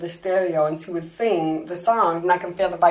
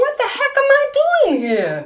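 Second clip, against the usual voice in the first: much higher, startled cry of self-doubt; panicked disbelief; 3 dB louder than usual. Retold or imitated. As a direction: suddenly realise you're out of your depth. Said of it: "I'm going".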